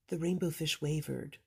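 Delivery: ordinary delivery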